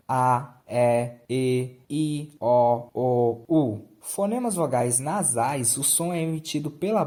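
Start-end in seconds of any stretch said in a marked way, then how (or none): 0.1-3.9 A, E, E, I, O, O, U as pure open mouth sounds; oral vowels. Shown as a demonstration. Teacher demonstrating sounds made only through the mouth, oral A, E, E, I, O, O, U. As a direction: recite A, E, E, I, O, O, U singly, loud, no nasality. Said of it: "o som é emitido apenas pela boca".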